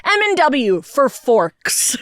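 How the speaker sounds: slow, deliberate read of the engraving; slow deadpan reading. Retold or imitated. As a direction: spell it out slowly, deadpan. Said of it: "In, like, really cute font".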